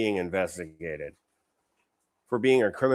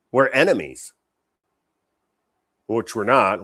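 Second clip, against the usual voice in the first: usual speaking voice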